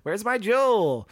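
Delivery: put-on high, sing-song Valley Girl voice; Valley Girl whine. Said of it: "it just goes into, like, a".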